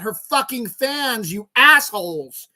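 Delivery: slow, high, loud insult; angry insult. Real, not acted. Cursing at entitled fans, slow furious shout. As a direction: spit the insult out slowly.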